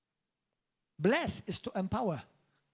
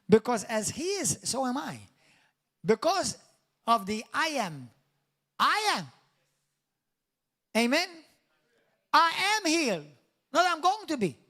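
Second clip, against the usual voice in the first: high, loud declarations with pauses; forceful preaching; 7 semitones higher, 5 dB louder than usual. Acted. Preacher declaring to the church he is healed, forceful. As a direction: proclaim it to the congregation at full force.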